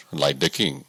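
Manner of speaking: ordinary delivery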